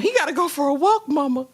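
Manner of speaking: high, plaintive mimicry; mock daughterly whine. Mimics the heartbroken daughter telling mama.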